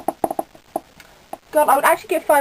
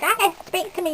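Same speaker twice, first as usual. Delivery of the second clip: imitating brother; 7 semitones higher than usual. Brother nags Alice, who is reading, to talk: higher nagging mimic. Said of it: "He's like".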